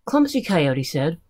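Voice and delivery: usual speaking voice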